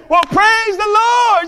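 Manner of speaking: high, loud and slow jubilant shout; dance and shout it to the rafters; shouting praise. Retold or imitated. Old lady dancing on the porch, jubilant shout. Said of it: "starts dancing and shouting".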